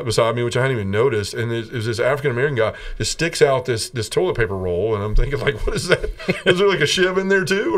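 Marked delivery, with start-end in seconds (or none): 5.7-7.7 higher-pitched, half-laughing alarmed question; puzzled disbelief. Retold or imitated. Inmate retelling his reaction to the toilet roll, puzzled disbelief. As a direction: voice your baffled inner thought, pitched up. Wary thought at odd offer.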